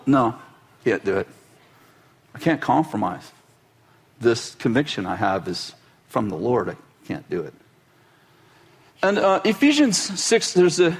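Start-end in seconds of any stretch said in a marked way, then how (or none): 0.1-7.5 much lower, quiet, immovable; Mordecai firm. Retold or imitated. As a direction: sink low and refuse softly. Mordecai's final refusal.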